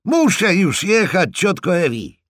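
loud, high call to get away; hurried shout. Acted. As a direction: yell it as a hasty goodbye. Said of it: "krzyknął pośpiesznie George".

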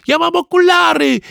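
put-on very high, squealing boy's cry; panicky shout. A narrator's reading. Ananiasz being chased by Rufus, shouting in alarm. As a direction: shout it as a high-pitched plea. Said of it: "który krzyczał".

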